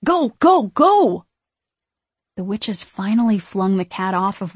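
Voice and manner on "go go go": loud, high repeated yell; urgent shout. A narrator's reading. Max hurrying two girls out, shouting. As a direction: shout them out of the door. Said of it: "he shouted at the two girls".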